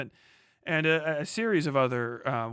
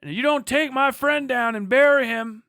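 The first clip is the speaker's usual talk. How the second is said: loud, higher growled threat with long pauses; hammed-up outlaw shouting his warning; menacing gunfighter threat; 9 semitones higher, 7 dB louder than usual. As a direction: threaten the whole saloon from the doorway. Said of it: "whatever the fuck it is he says".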